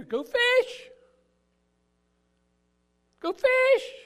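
high, loud shouted order; shouted command. Retold or imitated. Preacher shouts Jesus' command.

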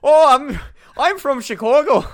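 Chicago attempt gone full Irish, high and loud; bad Irish accent. Host claiming to be from Chicago, mock Irish accent. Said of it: "I'm just going full Irish now".

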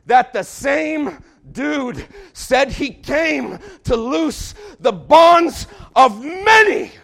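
slow, high, shouted proclamation; preaching at full force. Acted. Preacher shouting the promise to the congregation. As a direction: drive it home with a booming slow shout.

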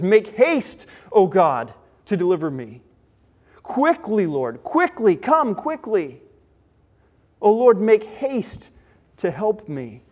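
emphatic cry to God for speedy rescue; urgent plea. Acted. David under attack begs God for rescue, urgent plea. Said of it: "he's feeling this urgency".

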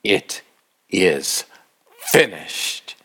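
low, word-by-word last words; measured, weighty declaration; solemn retold cry. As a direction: say each word separately, with a pause between. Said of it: "he cried out with a voice".

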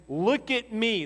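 a hint of a stern parent, "look" pressed; mildly imitating a parent demanding eye contact; lightly voiced firm parent. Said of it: "If a parent ever takes a child".

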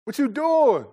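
loud, high "hey" across the room; father checking on a raging kid; dad calling out. Retold or imitated. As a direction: call out to the son mid-game. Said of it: "I'll be like".

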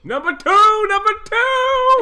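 high, loud announcer shout; hyped-up host calling out the name; game show call. Acted.

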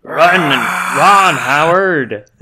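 loud echoing arcade villain; booming robot voice. Retold or imitated. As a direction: boom it like a game boss. Mock Sinistar robot boom.

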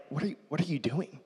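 dad catching his son ramming the toy car, fast and soft; retold dad reprimand. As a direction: snap it fast, half-amused. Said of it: "all of a sudden I'm like".

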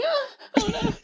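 high, hammed-up squeal of panic; mock panicked screams. Retelling screaming hostages to friends, high hammed-up shriek. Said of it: "you know how they were".

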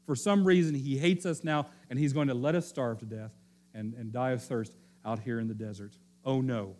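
usual speaking voice

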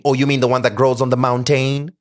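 mountain pronounced moun-TAYN to mimic plantain; loud, high retort stretching mountain into moun-TAYN; mocking rhymed mountain. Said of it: "he was super confused".

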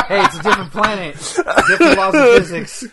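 loud laughing joke, words through laughter; laughing loudly. Real, not acted. Laughing joke about alien physics.

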